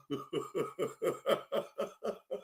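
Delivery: soft, low stuttering laugh. Real, not acted. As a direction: chuckle quietly at someone's begging. Host laughs at the begging.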